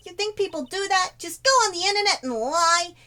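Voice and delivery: high, loud detective voice; indignant question in a big detective voice; Gumshoe. Acted. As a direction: meme line put through a cartoon detective. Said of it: "I did that in Gumshoe's voice".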